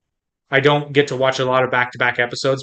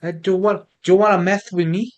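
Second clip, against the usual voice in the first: put-on high, lisping Spanish accent; hammed-up Spanish accent; 6 semitones higher than usual. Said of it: "Oh, with Spanish?".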